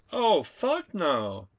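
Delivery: loud, slow, drawn-out no way; appalled loud slow no; emphatic refusal. Real, not acted. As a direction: recoil from the idea, loud and slow. Reacting to letting spider be.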